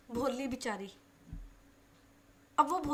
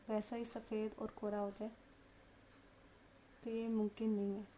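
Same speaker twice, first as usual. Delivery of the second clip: quiet sadness; 8 dB quieter than usual. Acted. Sad reflection on changed self.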